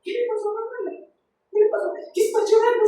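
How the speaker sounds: high, soft, quick questions; imitating someone calling about the crying girl; worried caller. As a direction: ask anxiously on the phone. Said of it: "le llamo, "Mamá".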